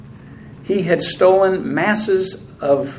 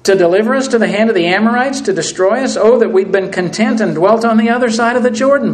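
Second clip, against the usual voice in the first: complaining Joshua; 5 semitones higher, 5 dB louder, faster than usual. Acted. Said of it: "Sounds like the complaining Israelites".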